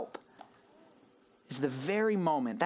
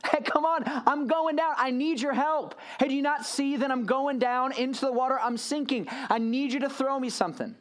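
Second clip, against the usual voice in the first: high, urgent mock plea; acted panic, pitched well up; calling for help; 8 semitones higher than usual. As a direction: call to friends on a boat while sinking. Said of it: "looked back at the boat and said".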